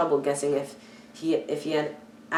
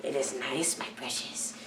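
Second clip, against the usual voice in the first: soft, lower creature hiss, half whispered; Gollum hissing with pleasure; 4 semitones lower, 8 dB quieter than usual. A narrator's reading. Gollum hisses to himself about tasty Bilbo, soft. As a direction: savour each word like a hungry creature. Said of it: "began to hiss a pleasure to himself".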